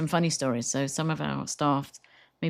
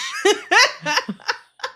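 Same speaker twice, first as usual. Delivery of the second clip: big laugh; 10 dB louder than usual. Real, not acted. Laughing at the quiche story.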